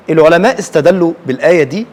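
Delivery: normal talking voice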